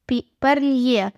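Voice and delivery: ordinary delivery, nothing marked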